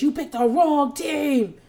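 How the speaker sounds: mock scream. Renee in Carla's face, mild mock yelling. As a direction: drag it out as a half yell. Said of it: "Renee is screaming in Carla's face".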